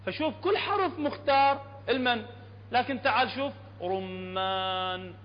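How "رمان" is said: رمان with long held aa and lingering ن; slow, sustained رمان; sweet drawn-out word. Shown as a demonstration. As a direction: savour رمان, stretching its ending. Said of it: "ايش قد حلوة".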